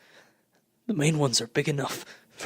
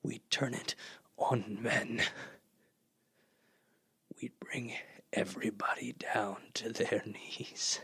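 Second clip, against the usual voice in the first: sinister whisper; 4 semitones lower, 10 dB quieter than usual. Acted. Artilleryman whispering his plan to turn the weapons on men, sinister. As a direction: drop to a menacing hush. Plotting to rule the world.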